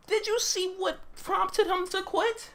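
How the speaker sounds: very high, slow outraged question; incredulous indignation. Real, not acted. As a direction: squeak it out in slow disbelief. Indignant question aimed at Garp.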